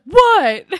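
very loud, high-pitched mock bellow; retold shout. Boy to kids staring at him, comic shout. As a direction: shout it out, imitating him for laughs.

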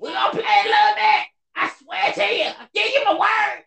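very high, sing-song promise to his son. Real, not acted. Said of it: "Y'all just heard me tell my little man".

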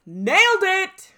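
loud, high, slow show-title shout; catchphrase shout. Pretend. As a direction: belt it like the show's catchphrase.

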